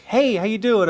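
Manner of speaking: friendly greeting. Retold or imitated. Bar patron greeting a newcomer, high chirpy hello. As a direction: toss off a cheery hello. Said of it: "No one's like".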